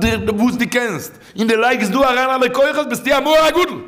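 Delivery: high-pitched urgent sermon delivery; impassioned preaching. Real, not acted. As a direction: preach it high, driving the point home. Exhorts the listeners at raised pitch. (פייערדיגע דרשה, הויך און דרינגענד)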